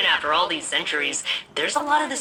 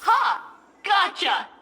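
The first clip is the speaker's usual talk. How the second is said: triumphant; much slower than usual. Acted. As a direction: pounce on it gleefully. Catches the specimen.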